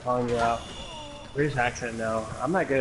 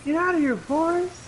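mock outraged cry. Player re-enacting a Forrest Gump scene, high mock cry. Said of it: "such a funny part in Forrest Gump".

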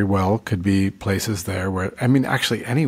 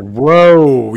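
overwhelmed whoa; 4 semitones higher, 9 dB louder than usual. Retold or imitated. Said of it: "where you're just like".

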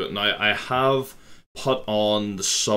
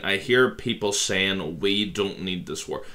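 ordinary talk

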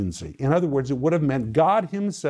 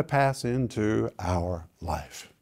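drawn out, sinking lower and softer; slow, trailing close; 5 semitones lower, 6 dB quieter, much slower than usual. Real, not acted. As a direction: let the phrase fall away slowly. Somber dragged-out end of sentence.

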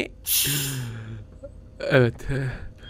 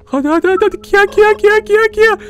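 frantic urging; 4 dB louder than usual. Real, not acted. Urging pet creature frantically.

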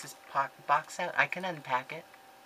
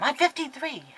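much higher and louder, triumphantly sure; emphatic confirmation; 11 semitones higher, 6 dB louder than usual. Real, not acted. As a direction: declare it like you just remembered. Confirming her age emphatically.

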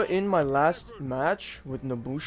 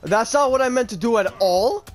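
raging gamer; 7 semitones higher, 6 dB louder than usual. Real, not acted. Botched move, frustrated outburst.

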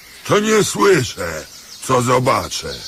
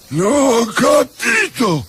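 mocking echo; 8 semitones higher, slower than usual. Beggar mocks leaseholder.

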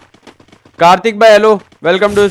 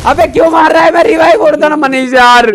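high, raised pleading complaint; exasperated protest; 4 semitones higher than usual. Real, not acted. Player telling Manish to stop shooting, exasperated high-pitched plea. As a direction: call out in frustrated protest.

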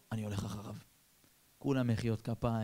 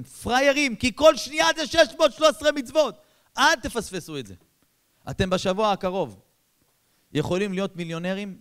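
much higher, louder rousing sermon; fiery exhortation; 8 semitones higher, 9 dB louder than usual. Acted. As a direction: drive the crowd to act. Preacher exhorts on tzitzit. (קריאה נלהבת לקהל בקול רם)

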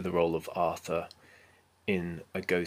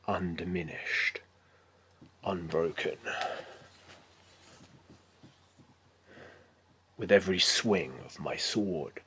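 slow, measured heroic monologue with weighted pauses; resolute knight Arthur; slower than usual. Acted. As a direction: play an unyielding armored warrior, deliberate and solemn. Self-tape monologue as knight Arthur.

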